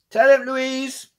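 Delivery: high, loud call from the back of a crowd; shouting from the back, hearing aid turned up; shouting granny. Acted. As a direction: yell it out like a half-deaf old lady. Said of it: "shouted one of the other grannies".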